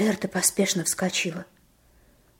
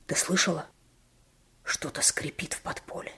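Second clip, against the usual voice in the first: whispering; 6 dB quieter than usual. Acted. Boy scares sister at night.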